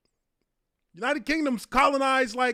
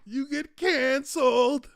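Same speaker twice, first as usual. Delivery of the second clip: ordinary delivery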